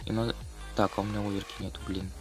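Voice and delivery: normal talking voice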